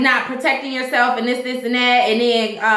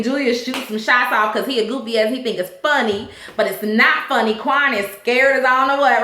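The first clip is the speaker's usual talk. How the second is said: ordinary talk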